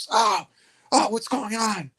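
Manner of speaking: high, startled yelps of a man just woken; high panicked just-woken voice; panicked wake-up. Retold or imitated. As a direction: freak out, voice jumping way up. Woken inmate freaking out.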